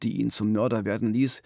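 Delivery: ordinary talk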